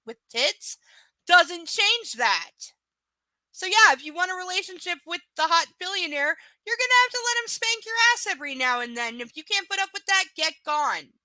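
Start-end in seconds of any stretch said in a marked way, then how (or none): 1.3-2.7 each word slowed and punched loud; slow emphatic punchline; emphatic slow point. Real, not acted. 3.5-10.6 high, loud, fast rant; escalating exasperated rant; heated rant. Real, not acted.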